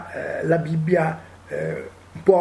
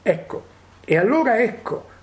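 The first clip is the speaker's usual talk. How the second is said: ordinary talk, nothing marked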